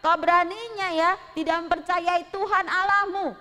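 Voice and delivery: loud, high accusing shout; shouting down a traitor; angry crowd. Acted. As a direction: townsfolk shouting at Nabot. Mereka marah-marah.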